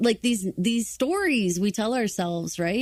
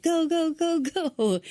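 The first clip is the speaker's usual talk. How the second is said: high urgent repeated "go"; firefighter shouting through mask; 9 semitones higher, much slower than usual. Retold or imitated. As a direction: hurry the crew out. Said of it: "It's like".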